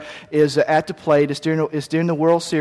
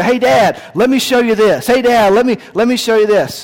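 put-on eager child calling Dad, higher and louder; excited kids; 5 semitones higher, 4 dB louder than usual. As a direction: bounce in like a six-year-old showing off.

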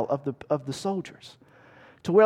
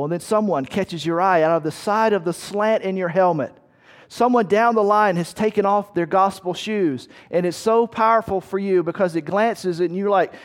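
ordinary talk

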